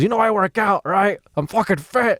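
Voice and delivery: high, mock tough-guy brag; drunk heckler bragging. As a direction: puff up like a drunk showing off. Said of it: "he goes".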